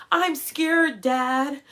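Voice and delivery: put-on high, loud frightened little girl; mock child's fearful plea; scared child. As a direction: cry out like a scared kid at the pool. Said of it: "Sally Mae is like".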